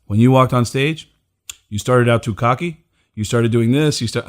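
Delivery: ordinary talk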